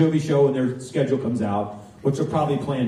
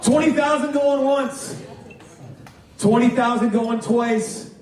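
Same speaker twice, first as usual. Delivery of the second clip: high, slow going-once call to close the bid; auctioneer's call; 10 semitones higher, slower than usual. Acted. Auctioneer closing the lot for the room, slow and high.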